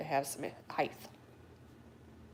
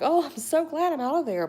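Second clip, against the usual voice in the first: relieved; 10 semitones higher, 7 dB louder than usual. Retold or imitated. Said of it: "And I was like".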